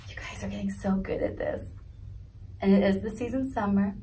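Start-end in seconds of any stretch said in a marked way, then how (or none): 0.1-1.7 whisper. Real, not acted. Teacher praising class softly.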